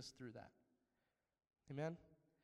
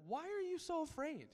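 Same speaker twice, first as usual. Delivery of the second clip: higher, pressing question as Jesus; stern Jesus, voiced higher; Jesus challenging; 11 semitones higher, 4 dB louder than usual. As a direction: put the challenge to frightened men. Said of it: "he actually asked them".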